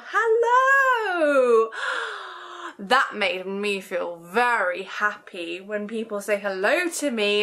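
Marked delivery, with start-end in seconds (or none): 0.0-1.7 high, loud sung hello; shouted hello. Shown as a demonstration. Librarian shouting a big hello to the babies. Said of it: "Shout hello". 1.8-2.7 gasp. Acted. Delighted gasp after song.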